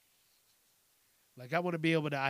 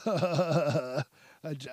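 real laugh; 3 dB louder than usual. Real, not acted. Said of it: "I'm feeling silly".

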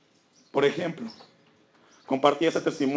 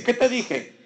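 acted scolding dad, pitched up high; mildly cross parent confronting a child; annoyed father; 7 semitones higher than usual.

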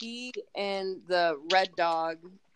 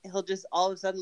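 normal talking voice

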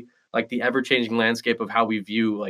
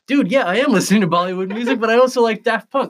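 louder, higher, exasperated retort; excited comeback; 10 semitones higher, 5 dB louder than usual. Retold or imitated. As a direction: fire back with animated emphasis. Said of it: "And I'm like".

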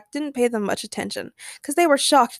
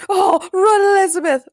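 high, loud cry of alarm; hammed-up storybook panic; frightened shout; 10 semitones higher, 7 dB louder than usual. A narrator's reading. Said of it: "Will shouted".

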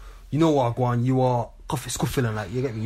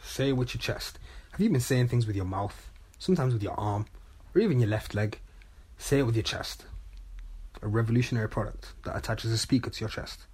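mock advert read; 2 semitones lower, 5 dB quieter than usual.

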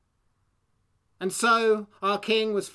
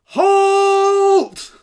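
huge high royal shout; yelling; 11 semitones higher, 12 dB louder than usual. Acted. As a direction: bark the halt at full volume. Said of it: "when the king yelled".

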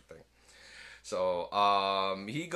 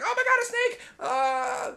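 high, loud hammed-up shriek at a snake; mock fright; 6 dB louder than usual. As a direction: yelp at the snake in panic. Man sees a snake.

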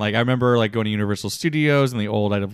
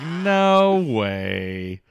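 drawn-out amazement, slightly raised; recalled disbelief; 3 semitones higher than usual. Retold or imitated. Fan recalling first seeing the photos, lingering disbelief. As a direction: stretch it out in stunned disbelief.

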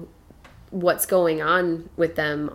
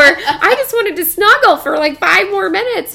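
put-on much higher, louder kid plea through laughter; pleading kid, laughing; 12 semitones higher, 11 dB louder than usual. Son begging mom for more snuggle time, laughing high whine. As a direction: beg for snuggles while cracking up.

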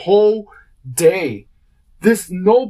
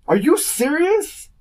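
disbelief; 10 semitones higher, slower than usual. Real, not acted. Incredulous at being called cop.

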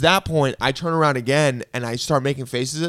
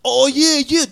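high-pitched amazed imitation; overheard excited guy; 9 semitones higher than usual. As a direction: mimic a guy thrilled by a TV commercial. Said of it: "I hear one of the guy, guys go".

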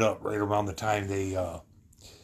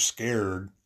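ordinary talk, nothing marked

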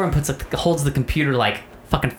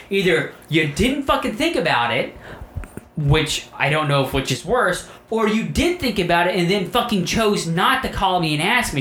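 higher, emphatic replayed argument; exasperated accusation; 7 semitones higher than usual. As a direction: corner them with both options, irritated. Retold quarrel, cornering partner.

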